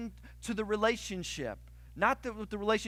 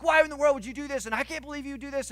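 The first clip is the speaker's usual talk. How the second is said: higher, louder and fast, imitating his old rant; retold angry outburst; 7 semitones higher, 6 dB louder, faster than usual. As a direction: replay the blow-up at your wife, hammed up. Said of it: "I'm like".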